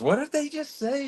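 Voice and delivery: husband mimicking his wife's confusion, high-pitched; imitating his wife. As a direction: pitch way up like someone lost in fast talk. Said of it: "She goes".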